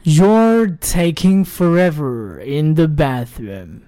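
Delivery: slow sentence with words run together; slow linked reading. Shown as a demonstration. Teacher repeats the slow model before speeding up. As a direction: read it slowly, all linked. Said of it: "再来一次".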